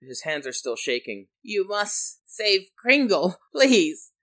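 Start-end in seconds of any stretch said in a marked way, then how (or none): 1.4-4.1 high, slow, loud begging; desperate gnome. Acted. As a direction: plead with the last of his strength.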